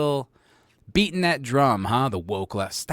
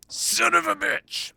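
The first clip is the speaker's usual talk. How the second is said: high, slow drawn-out curse; slower than usual. Acted. Streamer whining a curse, high and drawn out. Reacting to being trolled.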